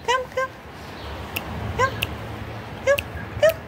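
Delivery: very high, soft coaxing call. Real, not acted. Calling the cat over, high soft coaxing. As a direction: coax the kitten closer softly.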